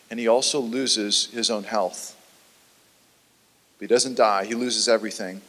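low, soft, sober delivery; hushed, solemn preaching. Real, not acted. Preacher recounting Job's losses to listeners, hushed and solemn. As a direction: drop into a low, weighty hush.

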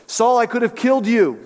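acted shout. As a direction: call out as if across a distance. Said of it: "David shouts to him".

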